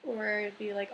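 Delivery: ordinary talk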